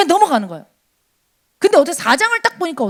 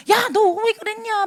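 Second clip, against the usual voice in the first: mock scolding; 8 semitones higher than usual. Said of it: "막 비난만 하고 책망만 하고".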